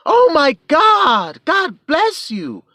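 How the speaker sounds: high, loud, slow cry of pity; loud high imitation of a shocked woman; shocked lady's exclamation. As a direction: cry out like a startled stranger blessing him. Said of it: "she literally exclaimed".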